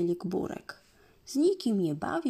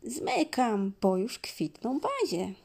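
cheery call; 7 semitones higher than usual. Acted. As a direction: wave off the listeners brightly. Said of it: "Krzyknął do nas".

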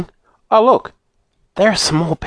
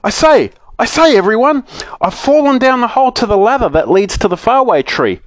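great excitement; 9 semitones higher than usual. A narrator's reading. Moonface calling up through the hole, excited. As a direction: bubble over with news. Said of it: "in the greatest excitement".

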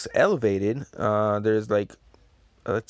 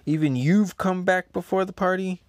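Kaido's voice; 8 semitones higher, 3 dB louder than usual. Acted. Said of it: "and then Kaido says".

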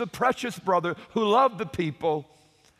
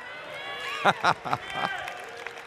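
breathy laugh; 3 semitones lower, 5 dB quieter than usual. Real, not acted. Laughs after his own exclamation.

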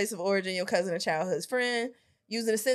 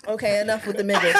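laughing; faster than usual. Real, not acted. Host laughing at the answer.

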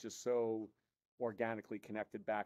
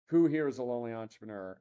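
louder retold call across the room; retold yell in a coffee shop; 8 dB louder than usual. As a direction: call it out loud like to a whole Starbucks. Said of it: "and he yells".

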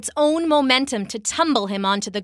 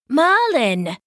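high, loud burst of astonishment; disbelief; 5 semitones higher, 5 dB louder than usual. A narrator's reading. Kneeling knight recognizing the wizard, in disbelief. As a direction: exclaim the name, pitch swooping up in disbelief. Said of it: "he said in disbelief".

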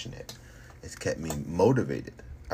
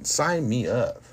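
excited shout; 7 semitones higher, 5 dB louder, slower than usual. Real, not acted. Fighter boasting knockout plans.

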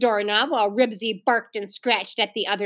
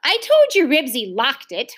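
raised, mildly shouted protest; insist, a touch louder; 4 semitones higher, 5 dB louder than usual. A narrator's reading. Ramona vindicates herself to the family, mild shout. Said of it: "Ramona shouted".

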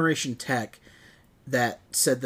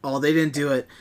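ordinary delivery

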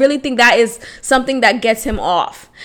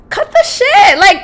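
much higher, shouted; exasperated outburst; 8 semitones higher than usual. Real, not acted. Fed up with the ex's empty promises, shouted. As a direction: snap it out in disbelief.

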